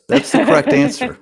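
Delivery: high playful announcement; mock game show. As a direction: squeal it like a quiz host. Host jokingly confirms answer.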